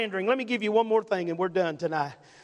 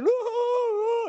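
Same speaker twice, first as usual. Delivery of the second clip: high-pitched imitation wail; hammed-up boo-hoo sobbing, way up high; mock weeping; 12 semitones higher, slower than usual. Preacher imitating a mother weeping outside Kmart, mock weeping. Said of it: "she's just weeping".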